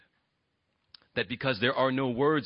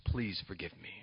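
soft plea; 9 semitones lower, 12 dB quieter than usual. Retold or imitated. Jacob's silent plea to Esau.

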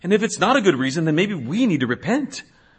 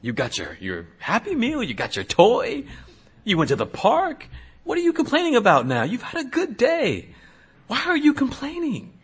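put-on higher, half-laughing scold of a child; exasperated parent; 6 semitones higher, 6 dB quieter than usual. Parent to a complaining kid after a good day, amused exasperation. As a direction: tease a whining kid who had it all. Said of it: "parents, we do this with our kids".

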